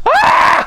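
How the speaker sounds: sudden high yelp of pain; high pained shout. Real, not acted. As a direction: cry out as the heat hits. Burning pepper pain.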